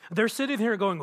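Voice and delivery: normal talking voice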